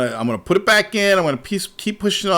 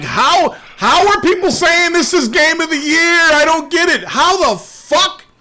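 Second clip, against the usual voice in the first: much higher and louder, disbelieving; incredulous rant; 11 semitones higher, 5 dB louder than usual. Retold or imitated. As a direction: whine in outraged disbelief. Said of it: "I'm like".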